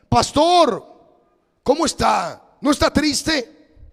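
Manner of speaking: high, loud, urgent voice of a worried church brother; worried brother calling the pastor, high and insistent; anxious caller. Retold or imitated. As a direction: sound like a fretting caller pressing the pastor. Brother presses the pastor on the phone.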